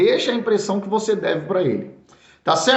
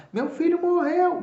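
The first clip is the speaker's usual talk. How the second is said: mother's anguished scream; 11 semitones higher than usual. Retold or imitated. Preacher recalls a mother crying over her child, high mock wail. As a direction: echo the mother's wail, high and thin, without volume. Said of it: "ela gritava".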